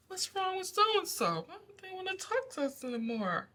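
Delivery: very high, small mock voice; puzzled former bullies. Bullies wondering why the empath went cold, mock-puzzled. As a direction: imitate them in a high-pitched wondering voice. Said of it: "goes from being so funny to".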